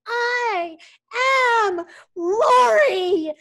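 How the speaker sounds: high, loud, drawn-out yell; retold triumphant scream, tearful and huge; imitated scream. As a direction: belt each word slowly with head thrown back. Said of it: "she screamed".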